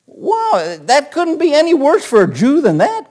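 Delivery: normal talking voice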